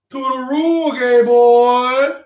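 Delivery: put-on high-pitched, drawn-out caricature of a Chinese accent; hammed-up Mister Chow-style Chinese accent; mock Chinese accent. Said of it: "Chinese accent there".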